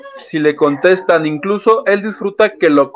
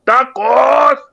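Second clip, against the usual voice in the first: mock laugh; 7 semitones higher, slower than usual. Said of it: "Pili Gaspar nos dice".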